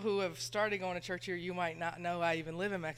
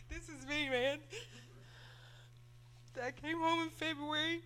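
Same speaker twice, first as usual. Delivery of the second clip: crying, voice pitched much higher; tearful; 7 semitones higher, 3 dB quieter than usual. Real, not acted. Daughter telling the church about her grandma, in tears. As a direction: choke the words out through real tears. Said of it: "I'm gonna cry".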